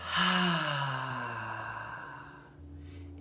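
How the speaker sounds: long open-mouth breath out; audible exhale sigh. Shown as a demonstration. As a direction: let the breath fall out with a sigh. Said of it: "In the nose".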